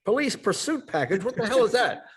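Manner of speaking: outraged question; hammed-up furious father; incredulous dad.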